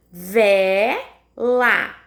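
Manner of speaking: "vela" split as véé... lá, low and slow; separando sílabas. Shown as a demonstration. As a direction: stretch and separate each syllable of "vela". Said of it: "como que eu separo a palavra vela?". (vela bem pausada, vé... lá)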